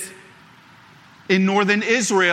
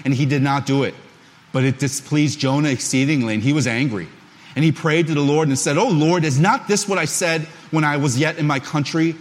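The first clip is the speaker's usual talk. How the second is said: lower, measured reading of scripture; 4 semitones lower than usual. Acted. Pastor reading Jonah aloud to the congregation, measured scripture reading. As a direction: read the Jonah passage to the congregation.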